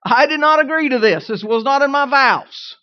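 loud, high, hammed-up husband's protest; comically outraged complaint, punched out loudly; indignant protest. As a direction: protest like a wronged groom, mock outrage. Acted protest of young husband.

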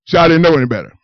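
ordinary talk

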